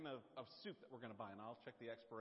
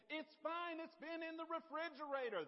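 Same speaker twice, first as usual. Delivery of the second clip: loud, very high dismissive shout; wife shouting a dismissive answer; wife yelling back; 13 semitones higher, 6 dB louder than usual. Retold or imitated. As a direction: fling it back, done with him. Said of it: "she'll say".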